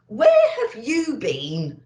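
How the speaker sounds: amused Santa. A narrator's reading. Santa greeting the munching reindeer, slow with a laugh. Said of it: "asked Santa".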